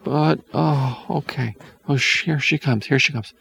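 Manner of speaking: nervous whisper. A narrator's reading. Hansel panics as witch returns.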